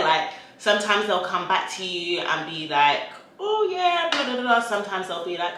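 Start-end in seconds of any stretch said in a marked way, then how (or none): 3.4-4.6 high-pitched mock agreement; put-on high voice reeling off a reply; brand saying yes. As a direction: imitate an eager reply, pitched way up. Voicing a brand's eager reply.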